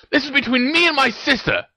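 high-pitched irritated warning; snapped. A narrator's reading. Ron shuts Hermione out of the sibling row, snapping. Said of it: "snapped Ron".

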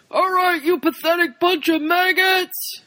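high, barked drill sergeant yell. Retold or imitated. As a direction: bark at the recruits like a sergeant. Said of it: "he's like a drill sergeant".

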